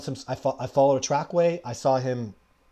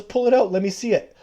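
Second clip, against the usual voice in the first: high, louder retold command; eager urging; 7 semitones higher, 5 dB louder than usual. Survivor Man urges him to show the scat, eagerly mimicked. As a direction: imitate someone impatient to see.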